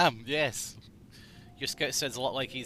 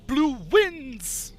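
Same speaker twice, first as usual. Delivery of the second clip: announcer line; 11 semitones higher, 6 dB louder than usual. Retold or imitated. Quoting game announcer lines.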